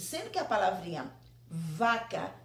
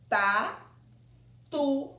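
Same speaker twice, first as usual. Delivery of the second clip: "Tatu" called out louder and higher, TA-TU in two beats; loud syllables; 4 semitones higher, 5 dB louder than usual. Shown as a demonstration. Teacher showing the children the syllables, louder "Tatu". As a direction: open the mouth wide on each syllable of "Tatu". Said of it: "abri a boca duas vezes".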